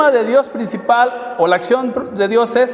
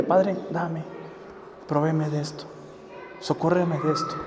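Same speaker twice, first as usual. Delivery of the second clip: soft sample prayer; 4 semitones lower, 11 dB quieter than usual. Pretend.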